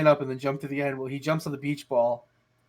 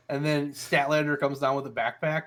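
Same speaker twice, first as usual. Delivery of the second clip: usual speaking voice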